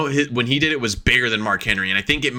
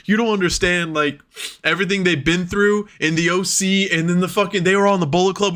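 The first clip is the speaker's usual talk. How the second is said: higher voice, imitating emotional pleading; retold tearful plea; 7 semitones higher than usual. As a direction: plead in a mildly worked-up, high voice. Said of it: "in the middle of me crying".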